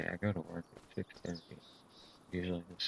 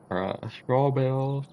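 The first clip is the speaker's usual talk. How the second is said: slow, higher, louder put-on voice; silly correction voice; 7 semitones higher, 10 dB louder, much slower than usual. Gamer correcting hay to straw in a goofy voice. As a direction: stretch it out in a goofy voice.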